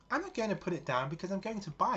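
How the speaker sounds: high, defiant refusal; indignant. Retold or imitated. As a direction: refuse firmly, offended. Daughter stands her ground.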